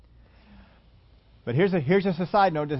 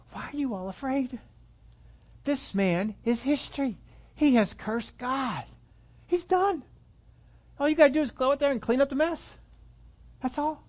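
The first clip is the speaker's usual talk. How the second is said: higher, brash voice of a bold youth; confident young David; 8 semitones higher than usual. Retold or imitated. Preacher acting David scoffing at the frightened army, higher and cocky. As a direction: sound like a fearless kid sure of winning. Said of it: "No one else was talking like this".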